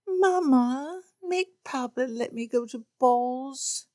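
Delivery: put-on high wheedling girl voice; girlish plea. Lydia appeals to Mamma, high wheedling plea. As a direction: appeal to mother sweetly.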